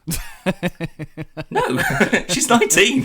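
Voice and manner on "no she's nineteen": high, slow laughing denial; laughing protest. Real, not acted. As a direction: laugh the joke off. Laughs off joke.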